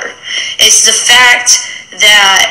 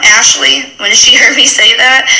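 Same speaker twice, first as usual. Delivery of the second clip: ordinary delivery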